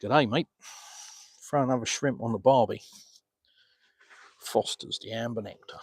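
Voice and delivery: put-on Australian accent for stock catchphrases; lightly hammed-up Aussie accent. Shown as a demonstration. Streamer obliging a viewer's request, best Aussie accent. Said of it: "Do your best Aussie accent".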